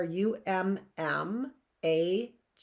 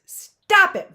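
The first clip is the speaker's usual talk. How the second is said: loud high cry; 12 semitones higher, 14 dB louder than usual. A narrator's reading. Violet cries out to stop Stefano, loud and high. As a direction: shout it out, much higher and louder. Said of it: "Violet said".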